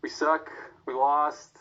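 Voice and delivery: ordinary talk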